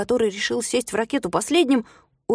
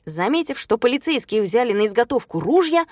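plain reading voice

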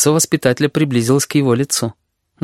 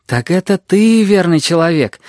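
higher-pitched glad realisation; delighted; 6 semitones higher than usual. A narrator's reading. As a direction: exclaim with mild delight. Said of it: "обрадовался кузнечик".

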